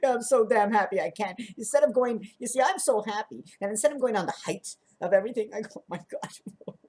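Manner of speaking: high, overwrought words through laughter; mock-tearful joy, dissolving into breathless laughter; happy laugh-crying. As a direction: gush as if about to sob with joy. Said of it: "it sounds like you're gonna cry".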